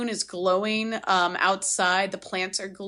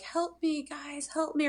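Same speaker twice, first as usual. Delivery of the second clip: high, soft mock plea; imitated cry for help; 6 semitones higher, 5 dB quieter than usual. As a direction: plead in a small high voice, imitating a distant cry. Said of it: "We still hear the cries".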